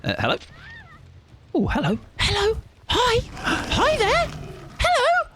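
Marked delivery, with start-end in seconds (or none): 1.5-5.3 squeaky high polite voice; high cheeky greeting. Pretend. Killer greeting a survivor before hitting them, high squeaky voice. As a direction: chirp like an overly friendly stranger.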